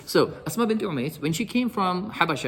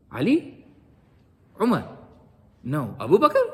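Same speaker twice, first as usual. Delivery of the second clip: playful guessing. Retold or imitated. Bilal held from behind, playfully guessing who. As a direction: try each name as a question.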